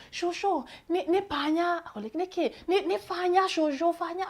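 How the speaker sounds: put-on high, flustered little girl stumbling over words; a touch of panicked kid voice; flustered child. Flustered child trying Kikuyu.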